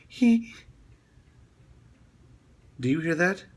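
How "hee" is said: high mock ghostly giggle; imitating a giggle. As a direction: squeak out a tiny creepy giggle. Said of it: "a giggle in the background that goes".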